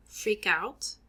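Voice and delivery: ordinary talk, nothing marked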